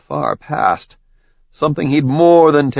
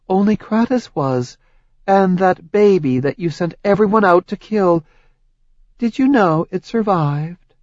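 higher, accusing goddess voice; Hera's voice; 8 semitones higher than usual. Acted. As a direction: lay out his crimes coldly. Hera answers Zeus.

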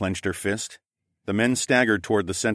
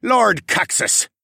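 high, loud, slow resentful name; spat out begrudgingly; 13 semitones higher, 6 dB louder, slower than usual. Acted. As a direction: spit the name out grudgingly. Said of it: "he spat out begrudgingly".

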